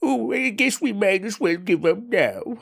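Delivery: high, whiny, defeated little ghost; Casper. Acted. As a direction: whine it slowly in a put-on high voice. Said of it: "Casper is such a downer in this game".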